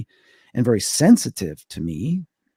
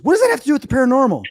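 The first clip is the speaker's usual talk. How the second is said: much higher, fast, incredulous; mock her impatient disbelief, quick and high; imitating Gail; 14 semitones higher, 5 dB louder, much faster than usual. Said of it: "And she goes".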